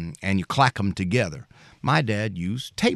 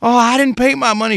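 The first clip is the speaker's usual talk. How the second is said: high, louder sheepish admission; Betty voiced; 13 semitones higher, 7 dB louder than usual. Betty admits to the utility man she didn't pay, high and loud. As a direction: own up like a caught debtor.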